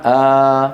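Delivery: آ as one long held vowel, no stop after it. Shown as a demonstration. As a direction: hold آ out long and steady. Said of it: "بيقولوا بدلا منها". (مدّ «آ» بدل الهمزة الساكنة)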